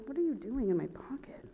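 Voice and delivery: hushed, voiceless aside; whispered aside. Real, not acted. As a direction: whisper it to yourself in puzzlement. Aside while searching for registration.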